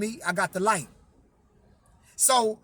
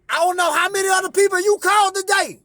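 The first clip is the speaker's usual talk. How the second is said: raised, louder and higher; emphatic retelling; 8 semitones higher, 5 dB louder than usual. Retold or imitated. Telling his dying cousin, raised and emphatic. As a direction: press the point home to her.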